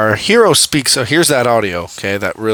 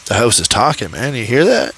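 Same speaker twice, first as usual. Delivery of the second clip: nervously notice the house; hint of spooked bystanders; mock spooked; 4 semitones lower than usual. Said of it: "They're like".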